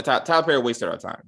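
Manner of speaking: ordinary delivery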